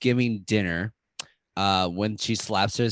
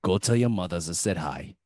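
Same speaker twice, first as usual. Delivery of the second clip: lower-pitched New York Italian wise guy; New York Italian accent; 4 semitones lower than usual. Acted. Staten Island character sends hello to their mothers, New York Italian accent. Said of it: "with a New York Italian accent".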